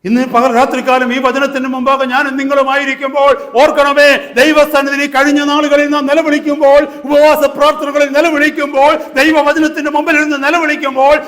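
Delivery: sustained loud, high pulpit cry; fervent full-force preaching. Real, not acted. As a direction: drive the question home to the congregation.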